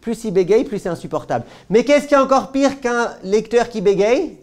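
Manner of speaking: ordinary talk, nothing marked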